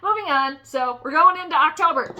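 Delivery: ordinary talk, nothing marked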